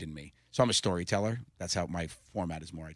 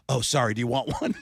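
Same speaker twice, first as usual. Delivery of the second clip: laughing; 5 semitones higher, 7 dB louder than usual. Real, not acted. Son offering Oreo, dad laughing.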